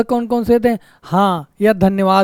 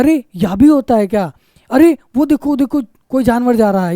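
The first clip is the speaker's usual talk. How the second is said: astonished; 3 semitones higher than usual. Shown as a demonstration. Teacher acts out spotting an animal on the road, astonished. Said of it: "तब उसके बाद कोई कहते हैं".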